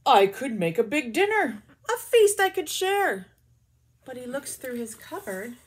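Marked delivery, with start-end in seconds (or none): 0.1-3.3 loud, hopeful bear voice. Acted. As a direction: voice the bear eagerly. Bear speaks in story.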